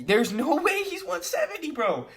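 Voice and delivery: very high-pitched, strained disbelief; incredulous squeal. Real, not acted. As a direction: shoot the voice way up in disbelief. Disbelief at a fighter's size.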